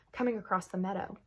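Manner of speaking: ordinary delivery, nothing marked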